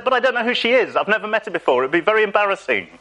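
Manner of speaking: high-pitched, hurried excuses to God; flustered protest. Acted. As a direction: object in a rising comic panic. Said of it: "I said".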